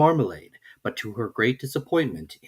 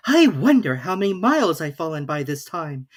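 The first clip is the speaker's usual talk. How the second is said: lightly voiced young girl, higher; Alice; 5 semitones higher, 4 dB louder than usual. A narrator's reading. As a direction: read Alice's lines a little higher and brighter in tone. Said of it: "she said aloud".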